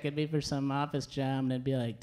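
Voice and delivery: normal talking voice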